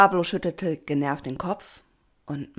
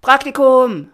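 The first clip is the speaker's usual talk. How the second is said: high, loud shout; called out; 8 semitones higher, 14 dB louder, slower than usual. A narrator's reading. Some of the swarm call to the patron, high shout. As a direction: shout the suggestion from the crowd. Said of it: "riefen einige".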